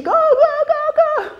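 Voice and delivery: high, loud mock shout of go; imitated yelling examiner. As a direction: yell it like an impatient examiner. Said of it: "Elle me crie dessus encore".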